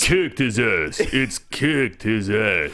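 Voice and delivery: low, drawn-out action-star impression; Stallone. Correcting the movie quote, low slow action-star impression. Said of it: "your Stallone".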